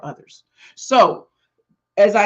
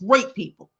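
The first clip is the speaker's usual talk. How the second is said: "Great people" punched higher and louder, falling; stress on great people; 5 semitones higher, 5 dB louder than usual. Real, not acted. Repeating it to her viewers, "Great people" stressed hard. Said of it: "Hear what I just said?".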